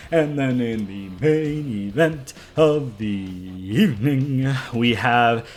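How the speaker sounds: slow, drawn-out mock announcer voice; hammed-up main event introduction; ring announcer. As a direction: stretch every word like a ring announcer.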